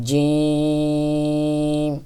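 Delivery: "জিম" with a solid j, long held vowel; thick sound. Shown as a demonstration. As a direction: stretch "জিম" steadily, thick j. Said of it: "মোটা আওয়াজ".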